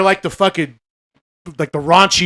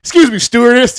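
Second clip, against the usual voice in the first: higher, louder polite goofy voice; John Candy's voice; 8 semitones higher, 7 dB louder than usual. Retold or imitated. John Candy quoted calling the officer, higher goofy voice. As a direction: call out politely, like a clueless passenger. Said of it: "John Candy comes walking up".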